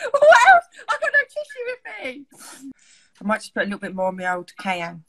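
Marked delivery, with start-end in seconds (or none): 0.1-2.3 1 laughing tearfully. Real, not acted. Eyes watering from pepper.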